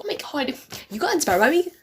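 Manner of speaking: high, squeaky mock outrage; mocking a possessive artist in a high squeak; mock outraged artist.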